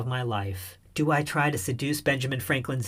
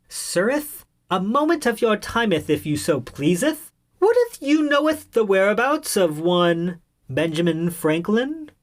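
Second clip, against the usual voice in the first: high, pompous fake old-timey speech; over-the-top olde English address; mock olde English; 6 semitones higher, 3 dB louder than usual. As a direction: ham up a fake Shakespearean gentleman.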